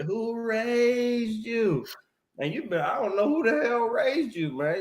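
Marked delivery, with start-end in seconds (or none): 0.0-1.8 higher, very slow drawn-out preacher question; T K Kirkland. Retold or imitated. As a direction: stretch each word like a preacher asking a hard question. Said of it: "like T K Kirkland ... always say".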